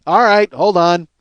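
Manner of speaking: loud, slow mock holler; resigned shout imitated from behind a door; called through door. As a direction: holler it back, drawn out. Woman answers repo man through door.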